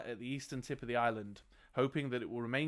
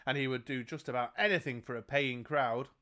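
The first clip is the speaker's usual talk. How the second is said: ordinary talk, nothing marked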